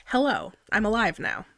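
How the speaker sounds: normal talking voice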